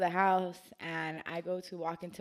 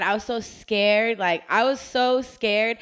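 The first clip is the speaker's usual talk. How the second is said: emphatic; 4 semitones higher, 12 dB louder, slower than usual. Real, not acted. Reliving the scare, emphatic.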